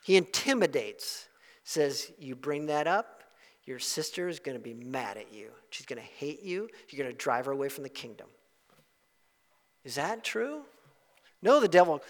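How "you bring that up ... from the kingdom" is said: quiet threat. Retold or imitated. Devil voiced threatening.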